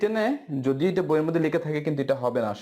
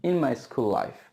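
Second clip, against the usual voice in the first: slow model sentence; much slower than usual. Shown as a demonstration. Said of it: "আবার".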